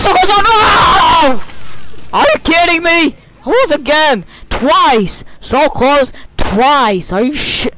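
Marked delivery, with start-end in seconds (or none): 0.0-2.2 high wail rising to a loud scream; anguished shout. Real, not acted. Gamer just missing a goal, anguished scream. 2.2-7.1 high, exasperated cry; outraged disbelief. Real, not acted. Gamer reacting to a near miss, outraged disbelief. Just missed a goal.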